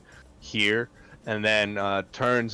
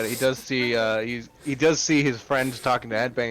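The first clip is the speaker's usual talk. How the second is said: usual speaking voice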